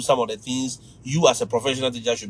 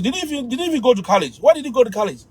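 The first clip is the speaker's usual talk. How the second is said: high, quick student challenge; cheeky student; 7 semitones higher, much faster than usual. Retold or imitated. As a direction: put on a student doubting the teacher. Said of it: "these students can ask you".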